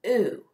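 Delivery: a long pure "oo" held out clearly; modelled oo sound. Shown as a demonstration. Teacher models "oo" for her young class, drawn out. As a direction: say "oo" slowly with rounded lips for the class. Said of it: "the same vowel sound".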